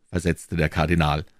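usual speaking voice

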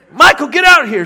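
high, loud summons; commanding shout to Michael; calling out. Retold or imitated. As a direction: holler across the yard to Michael. Preacher calling him outside.

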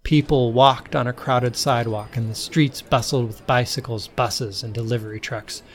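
usual speaking voice

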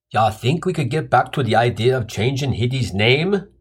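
mildly annoyed tough guy cutting in; slightly irritated big guy. Acted. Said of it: "chimes in the slightly irritated big brawny guy".

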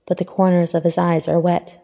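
plain reading voice

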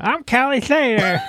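mocking, high-pitched silly puppet voice; Kelly Slater. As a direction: make fun of him in a squeaky put-on voice. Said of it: "they started talking".

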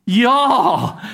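y'all drawn out as a southern jaw. Shown as a demonstration. Pastor leading the congregation in saying y'all, southern. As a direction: say y'all like a Texan. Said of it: "Can y'all say that?".

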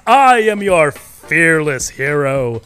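loud, slow showman intro; announcer voice. Acted. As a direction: welcome the crowd like a ringmaster.

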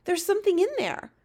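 put-on high-pitched excited girl's cry. A narrator's reading. Girl spots the dream in the jar, crying out. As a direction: squeal it out in amazement. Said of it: "cried out".

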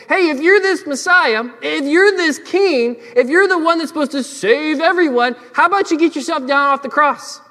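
high, loud jeering challenge, easing off toward the end; mocking taunt. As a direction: imitate a heckler piling on insults. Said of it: "insult Jesus and mock him and shame him".

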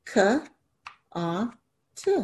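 k, a, t as separate letter sounds; sounding out letters. Shown as a demonstration. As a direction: phonics letter sounds, one by one. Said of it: "pull the sounds down for each syllable".

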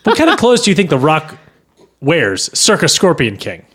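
ordinary talk

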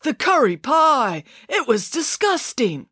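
slow, high, drawn-out revulsion; exaggerated disgust at food. A narrator's reading. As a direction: relish how revolting it was. Boy recalls awful dinner with disgust.

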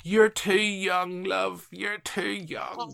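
high, slow, drawn-out call imitating a dad; dad's distant call. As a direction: call it plaintively from the back of a room. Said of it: "this voice from the very back of the room just kinda going".

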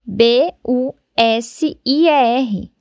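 letters spelled slowly; slow spelling. Shown as a demonstration. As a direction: spell each letter clearly. (soletrando devagar)

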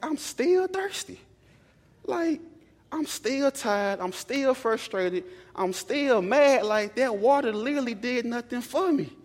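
high, slow, drawn-out whine; exhausted player whining that the water did nothing; whiny complaint. Retold or imitated. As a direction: stretch every still like a sulking kid. Whining retold self after water.

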